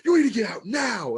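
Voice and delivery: loud, high angry shout; retold teacher blowing her lid. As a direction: yell at the kid to get out. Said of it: "She's like".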